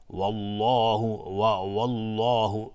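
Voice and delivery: والله with a thick dark l and rounded vowel; heavy Allah. Shown as a demonstration. Teacher showing tafkhim, heavy والله. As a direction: round the lips and fill out الله in والله. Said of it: "مفخم باشه".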